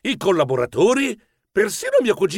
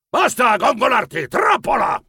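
high, irritated shout; bellowing grandfather; 7 semitones higher than usual. Acted. Grandfather cutting off boastful cousin, bellowing crossly. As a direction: yell to cut off the gloating. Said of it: "nonno Torquato sbraitò".

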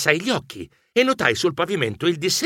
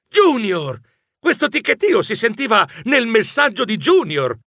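higher, calling out Junior with a sudden realisation; excited shout; 7 semitones higher than usual. Acted. Geronimo shouting his realisation to Rebella, excited shout. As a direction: cry out the discovery.

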